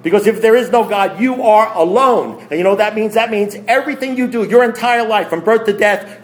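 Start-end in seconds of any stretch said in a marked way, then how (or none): 1.2-2.4 slow emphatic preaching. Acted. Preaching refrain, slowed.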